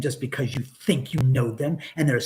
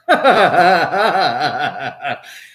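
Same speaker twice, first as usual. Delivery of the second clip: burst of laughter; 8 dB louder than usual. Real, not acted. Cracking up at the memory.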